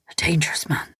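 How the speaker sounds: a man whispering an apology to the woman. A narrator's reading. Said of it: "just a whisper".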